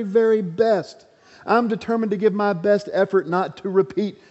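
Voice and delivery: high, impassioned preacher's build; fervent sermon climax; preaching. Acted. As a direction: preach it from the pulpit, rising. Preaches at full force.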